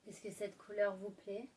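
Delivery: seduce the listener gently; sultry narrator. Acted. Intimate ASMR roleplay, lipstick.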